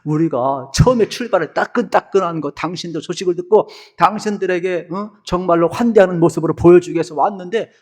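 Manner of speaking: high-pitched, hammed-up tearful appeal; acted wailing plea, pitch climbing towards the end; plaintive pleading. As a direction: beg desperately, piling up excuses. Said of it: "얼마나 애절하게 여호수 앞에 매달리는지".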